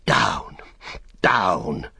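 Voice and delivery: short, gasped order to back off; gasped command. A narrator's reading. Frodo flinging off his attacker, gasping a command. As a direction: gasp out the command with a falling tune. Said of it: "he gasped".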